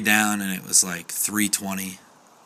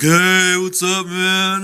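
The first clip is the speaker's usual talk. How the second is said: put-on a loud, high, drawn-out drunken greeting; drunk bartender; 10 semitones higher, 7 dB louder, much slower than usual. Dan stumbling out of the bathroom, loud drunken hello. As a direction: greet him like a cheerful drunk. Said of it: "and he was like".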